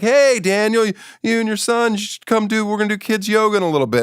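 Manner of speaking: high, fast, chirpy yoga-guy voice; eager yoga guy. Retold or imitated. Yoga guy inviting dad and son over, high eager voice. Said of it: "Guy immediately came up to me and was like".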